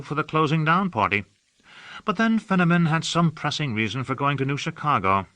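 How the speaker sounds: affected aristocrat. A narrator's reading. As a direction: gush about the city like a bored aesthete. Hawke gossiping airily.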